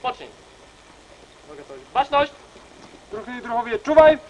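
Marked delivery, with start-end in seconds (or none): 1.9-4.2 loud parade-ground command; drill sergeant. Real, not acted. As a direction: shout the commands to the ranks. Scout parade commands to assembly.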